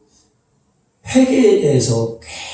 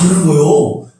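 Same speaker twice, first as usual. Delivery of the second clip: usual speaking voice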